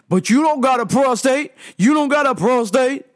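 hammed-up outraged customer yelling; mock customer shout. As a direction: throw it at her like an accusation.

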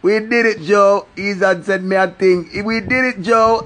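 over-the-top victory shouting; ecstatic catchphrase. Acted. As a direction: scream it like you just won big.

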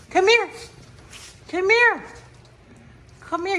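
high coaxing call; sweet high call to a dog. Real, not acted. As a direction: coax the puppy over. Calling dog.

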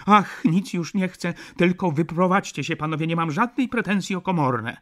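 high, loud, desperate exclamation; exasperated outcry. A narrator's reading. Baroness begging lodgers just to move out, crying out. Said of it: "zawołała baronowa".

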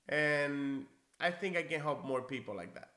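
ordinary delivery

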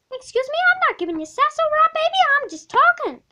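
high, loud, fast protest; indignant sass. Acted. Sassy student protesting to the teacher, high and fast. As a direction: fire back with offended sass.

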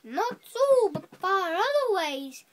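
high, emphatic mariner's retort; defiant mariner. A narrator's reading. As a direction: call back boldly as the stubborn mariner. Mariner defiantly answers the whale.

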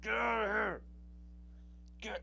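high, loud frustrated shout; angry shout at the screen; annoyed yell. Real, not acted. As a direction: yell at the ad to go away. Yelling at pop-up ads.

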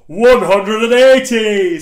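huge, loud darts-caller bellow. Pretend. Poet roaring the darts caller's cry of one hundred and eighty. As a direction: roar it like the darts announcer.

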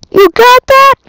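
sudden loud high shout; mock yell. Yelling at the game in mock anger, loud.

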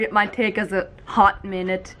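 normal talking voice

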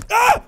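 very high, loud shriek; sudden shriek of fright; real scared scream; startled scream. Real, not acted. Jump scare.

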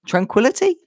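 unsure read, lifting at the end; Tranquility? as a puzzled question; questioning try. Shown as a demonstration.

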